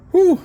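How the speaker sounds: loud, breathless exhaled whew; winded whew. Real, not acted. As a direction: puff it out, winded. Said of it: "Sorry, I'm breathing heavy. I'm winded".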